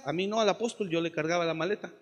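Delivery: ordinary delivery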